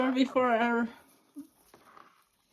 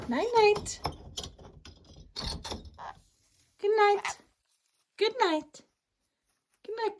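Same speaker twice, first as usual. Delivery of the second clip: sing-song goodnight; 9 semitones higher than usual. Real, not acted. Parent saying goodnight to baby.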